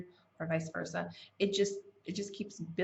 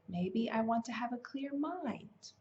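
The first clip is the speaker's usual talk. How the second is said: normal talking voice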